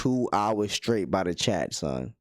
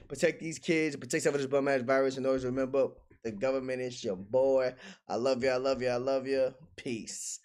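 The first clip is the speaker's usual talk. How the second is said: softer quick sign-off voice; stream outro; 3 semitones higher, 4 dB quieter than usual. Acted. Streamer signing off to viewers softly.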